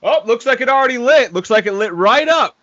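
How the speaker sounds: high, loud mocking imitation of the repairman; mocking gas guy. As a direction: sneer it out like a smug worker. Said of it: "talking shit".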